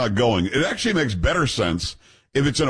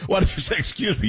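laughing; 6 semitones higher than usual. Real, not acted. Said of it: "laughing at cohost's excuse me".

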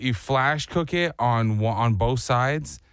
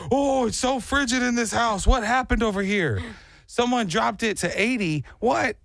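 high-pitched mock outrage at the cold; imitated freezing friend; 9 semitones higher than usual. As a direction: play a friend who woke up freezing, pitched way up. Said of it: "like, w-, in Antarctica".

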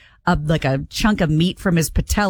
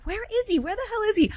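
much higher and softer, puzzled retold question; mock bewilderment; 13 semitones higher, 6 dB quieter, faster than usual. Caregiver recounting finding the patient gone, mock bewilderment. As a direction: imitate your own baffled searching, high and hushed.

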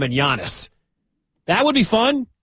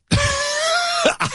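high burst of laughter. Real, not acted. Host laughing at idea.

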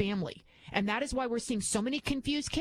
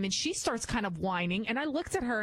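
normal talking voice

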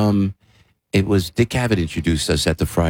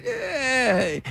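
high mock wail; 5 dB quieter than usual. Comic wail after bit.

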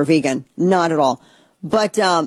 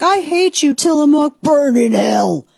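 much higher, shrill-free mock outrage, slowing on the last words; mock hater; 9 semitones higher, 4 dB louder, slower than usual. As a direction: ham up a furious fan, then drag it out. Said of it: "people are like".

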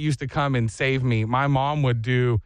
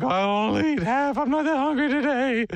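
put-on laughing kid bargaining; 8 semitones higher than usual. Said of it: "I'd be like".

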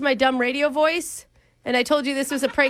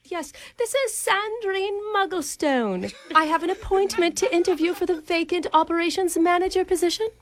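put-on plummy British accent, raised pitch; prim upper-class English voice; posh British accent; 4 semitones higher than usual. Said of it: "I'm just a regular Margaret Thatcher".

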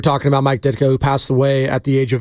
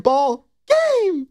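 much higher, mimicking a sung finish; high-pitched imitation; 12 semitones higher than usual. Radio host mimics his favorite end of Ditka's ball game song, high-pitched. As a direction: imitate the squeaky-high last note of a song. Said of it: "just, like, so high-pitched".

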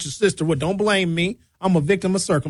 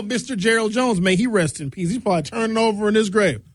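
ordinary delivery

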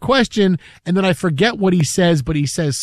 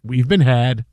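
lower, calm and wry; calm wry resignation; 5 semitones lower than usual. Retold or imitated. Anchor calmly admitting on air they were fooled. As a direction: take the loss with a smile. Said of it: "He goes, he smiles".

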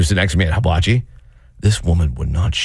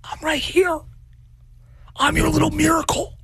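put-on very high childish whine; whiny child. Son whining for his mom's attention. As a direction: plead like a needy baby. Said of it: "I'm like".

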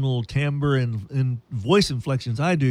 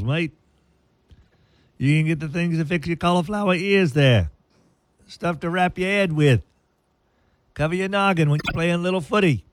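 sponsor spot in a hammed-up Aussie voice; chirpy Australian huckster, mate at every turn; Australian accent; 4 semitones higher than usual. Radio host reads the Sports World ad in Australian accent. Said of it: "I didn't know he had an Australian".

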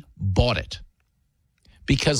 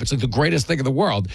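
ordinary talk, nothing marked

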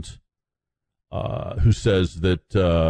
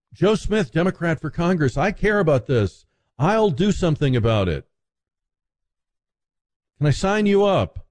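higher-pitched canvasser's pitch; eager political candidate; 6 semitones higher than usual. Acted. Democrat candidate working the fringes of a rally, eager pitch. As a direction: pitch yourself high and keen to a prospective voter. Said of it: "Dem candidates".

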